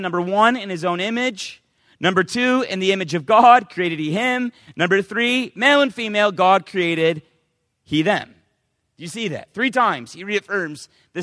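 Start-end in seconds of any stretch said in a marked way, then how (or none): 2.7-4.5 loud, solemn reading of holy words; loud preacher reading scripture. Acted. Reading scripture aloud in sermon. 5.5-8.3 loud, solemn reading of holy words; loud preacher reading scripture. Acted. Reading scripture aloud in sermon.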